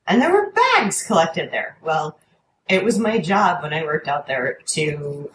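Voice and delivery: usual speaking voice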